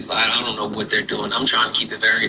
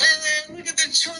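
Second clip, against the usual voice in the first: put-on high childlike voice. High playful voice.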